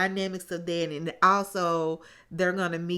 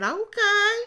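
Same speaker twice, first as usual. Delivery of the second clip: much higher, louder, sing-song Okay; exaggerated okay; 14 semitones higher, 8 dB louder than usual. Retelling Kirsten's reply to Shaq, hammed-up okay. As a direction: toss off a big mock Okay.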